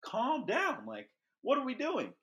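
high, frantic plea to a dad throwing too hard; panicked high plea. Retold or imitated. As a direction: squeak it out like a frightened nine-year-old. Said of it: "I'm like".

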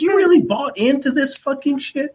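high, loud disbelief; incredulous mocking. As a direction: scoff at him in disbelief. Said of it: "I'm like".

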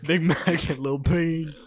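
slow, loud announcer call, last name squeaked high; slow, loud mock announcer, ending high; hype announcer. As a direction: announce the act like a hype man. Announcing the duo.

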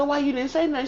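retold self confronting her friend; accusing. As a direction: fling the accusation high. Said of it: "I said".

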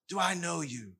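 low soft sneer, planting doubt; quiet taunting. Shown as a demonstration. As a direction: murmur it to make him doubt. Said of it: "trying to get Jesus to doubt himself".

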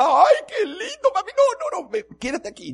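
put-on very high, loud squealing girl voice; thrilled daughter. Daughter squeals at dad about the cobra, delighted shout. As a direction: shriek with delight like an excited child.

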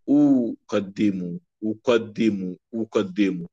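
أقدم said slowly as uqaddimu; model pronunciation. Shown as a demonstration. Teacher modelling أقدم for students. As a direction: say أقدم slowly with damma on the hamza. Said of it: "بكسر الدال".

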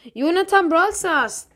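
loud, slow winner reveal; drawn-out drumroll winner announcement; hyped giveaway announcement. Real, not acted.

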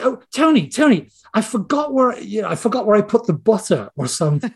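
high-pitched mock mom interrupting her son; fussing mom. Mother beeping in on her son's work, fussing mock-mom voice. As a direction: put on a high, bothering-mother voice. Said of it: "it would be like anybody's mom".

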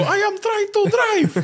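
high, drawn-out mock wail; flustered driver. Struggling driver answering boss's call, high mock wail. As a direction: wail it slowly in exasperation.

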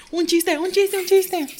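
high frantic inner voice; panicked inner voice. Retold or imitated. Her inner voice panicking for a joke, high and frantic. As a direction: panic inside your head. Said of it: "las [name] por dentro".

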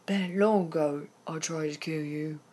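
lightly imitated Captain Hook accent; terrible accent. Coaching co-host on monologue, badly faked Captain Hook accent. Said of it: "This is a terrible accent".

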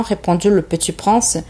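ordinary delivery

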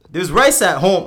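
loud Nigerian dad voice. Retold or imitated. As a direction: bellow it like a strict Nigerian dad. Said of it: "I was trying to do my dad".

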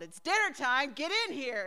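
high-pitched mock holler; re-enacted yell, pitched well up; retold yell. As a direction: call a little brother in, half-shouting. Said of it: "and I yelled".

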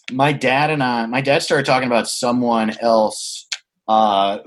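usual speaking voice

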